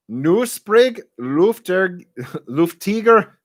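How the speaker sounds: high, slow mock-German; hammed-up German accent; funny German accent. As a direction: stumble through it in comic German, laughing. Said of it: "the funniest accent ever".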